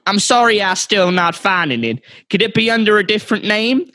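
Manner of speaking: put-on Southern American politeness; Southern American accent. Clerk apologising to the customer in a Southern American accent. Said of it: "from the south of America".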